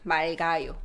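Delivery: 맑아요 said as "말가요", both ㄹ and ㄱ sounded; teacher models 맑아요 with both consonants clearly linked; pronunciation demo. Shown as a demonstration. Korean teacher to stream viewers, modelling 맑아요 as "말가요". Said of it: "we pronounce both".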